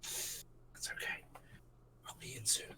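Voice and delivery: soft whisper. Acted. Dorgan answers quietly at cellar.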